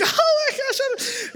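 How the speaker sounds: hammed-up blubbering through a laugh; mock ugly crying. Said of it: "like, ugly crying".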